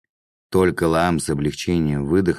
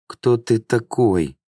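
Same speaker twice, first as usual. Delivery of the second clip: slow, higher, moaned question; pained moan; 6 semitones higher, slower than usual. A narrator's reading. Pinned victim asks his attacker, moaning slowly. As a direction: draw it out as a moan from a beaten man. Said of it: "простонал Лаам".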